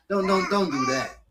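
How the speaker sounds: ordinary talk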